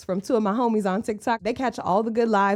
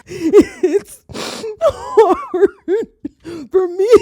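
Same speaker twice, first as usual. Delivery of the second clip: 1 mock crying; 9 semitones higher, 8 dB louder, much slower than usual. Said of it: "What you getting up here crying for now".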